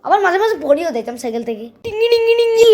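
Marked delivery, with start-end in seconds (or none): 1.8-2.7 high, loud ringing bell noise; bell imitation. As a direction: ring it like a bike bell.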